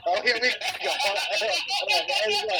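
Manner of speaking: laughing it out. Real, not acted. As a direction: here we go through laughter. Laughing here we go.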